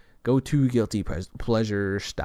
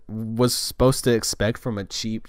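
ordinary delivery

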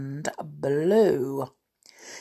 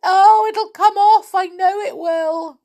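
high wailing plea; 11 semitones higher, 6 dB louder than usual. A narrator's reading. Pleading Tom.

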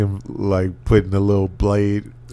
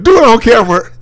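much higher and louder mock order; mock command; 12 semitones higher, 8 dB louder, faster than usual. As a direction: bark the demand like a captor. Voicing her command, acted.

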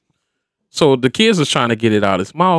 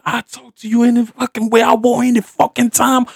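high-pitched retold angry outburst; retold anger; 10 semitones higher than usual. Stepfather charging at his stepdaughter, retold anger. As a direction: re-enact losing your temper, pitch up. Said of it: "it pissed me the fuck off".

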